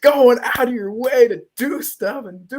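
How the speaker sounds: very high, loud mock tirade; hammed-up furious screech. Wife cutting off husband's apology, hammed-up screaming rage. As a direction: explode into an over-the-top shriek. Voices Eve exploding at Mark.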